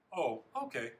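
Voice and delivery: casual, brushing-off reply; feigned indifference. A narrator's reading. Boy dodges parents' field day question, nonchalant. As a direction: make it sound not worth discussing. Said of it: "trying to sound like it's not worth talking about".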